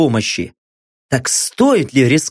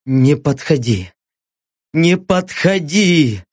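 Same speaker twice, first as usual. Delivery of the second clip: pleading; slower than usual. Acted. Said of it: "мысленно умолял её Лёха".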